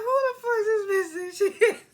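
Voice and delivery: high, loud outraged outburst laughing through it; outraged yell. Retold or imitated. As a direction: blow up in disbelief, half laughing. Outraged at Tiff's nanny demand.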